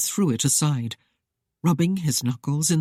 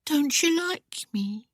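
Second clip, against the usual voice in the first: slow, high and softer coaxing; slow sultry coaxing, much higher pitch; coy seduction; 11 semitones higher, 3 dB quieter, slower than usual. Acted. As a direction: tempt him slowly. Heroine's coy seduction line.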